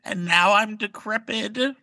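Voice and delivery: put-on high quavering old witch; very old faint voice. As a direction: quaver like a decrepit hag. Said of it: "pretend like I'm the old witch".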